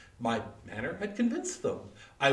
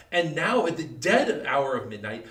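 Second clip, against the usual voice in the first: ordinary delivery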